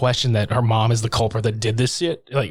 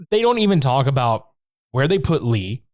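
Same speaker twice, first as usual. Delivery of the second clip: ordinary talk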